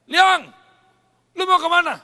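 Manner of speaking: loud, high call across the street. Retold or imitated. Friends calling out to him. (memanggil keras dengan nada tinggi)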